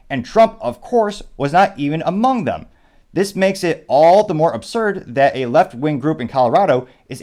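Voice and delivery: reading a statement aloud. Real, not acted. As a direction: read it out firmly, like a formal statement. Reads a candidate's written statement aloud.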